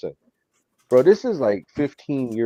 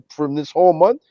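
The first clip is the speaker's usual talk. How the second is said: usual speaking voice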